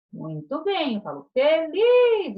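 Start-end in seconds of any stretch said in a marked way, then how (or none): 1.3-2.4 FELIZ with a loud, high, stretched LIZ; tônica na última sílaba. Shown as a demonstration. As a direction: stress the final syllable of FELIZ. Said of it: "a tônica é o lis". (feliz, esticando o LIZ final)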